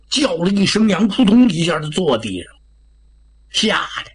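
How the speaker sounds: plain reading voice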